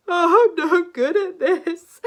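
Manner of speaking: loud, slow hammed-up wail; dragon despairing. Zog failing to capture a princess, wailing loudly. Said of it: "he cried".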